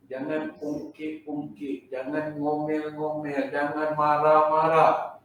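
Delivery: drawn-out, chant-like list of don'ts; measured, lingering warning; slow admonition. Real, not acted. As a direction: stretch each warning slowly and evenly. Listing conditions as slow admonition.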